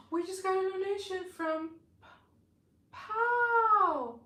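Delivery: gasping, high and loud surprise; excited. Real, not acted. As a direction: blurt it out, thrilled. Surprise donation on stream.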